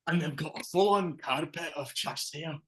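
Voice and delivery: high hammy Scottish voice; mock Scots husband musing; Scottish accent.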